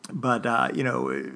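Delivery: ordinary talk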